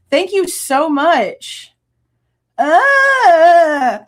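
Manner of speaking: slow, loud, overwhelmed; real emotional gushing; gushing thanks. Real, not acted. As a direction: pour out thanks, blown away.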